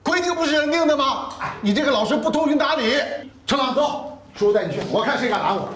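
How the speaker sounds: loud, high-pitched indignant challenge; angry outburst. Acted. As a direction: lose your temper and defy the official. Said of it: "你什么时候来这么大脾气啊". (怒气冲冲地大声质问)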